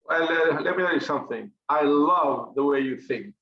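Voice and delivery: normal talking voice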